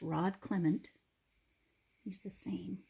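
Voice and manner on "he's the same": soft whispered repeat; whispered aside. Real, not acted.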